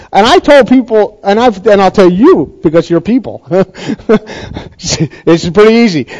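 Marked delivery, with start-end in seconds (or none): 3.5-4.6 a quick soft laugh at his own joke; short laugh. Real, not acted. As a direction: chuckle softly.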